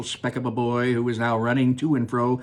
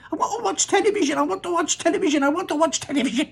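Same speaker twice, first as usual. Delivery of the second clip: put-on very high, fast tiny-boy chant; the shrunken boy keeps demanding television; squeaky tantrum; much faster than usual. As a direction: rattle it off in a squeaky fit. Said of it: "screaming and yelling".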